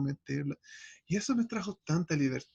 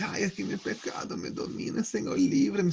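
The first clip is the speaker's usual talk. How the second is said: mock whiny plea. Mocking the believer's lament.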